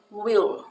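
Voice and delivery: Will said clearly with a firm w and L; showing the contraction sound; will demo. Shown as a demonstration. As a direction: say Will crisply. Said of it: "a pronúncia will".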